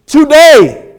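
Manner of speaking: high, loud declaration of a promise; emphatic proclamation. Retold or imitated. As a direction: proclaim it ringing out to the whole church. Preacher voices Jesus' immediate answer.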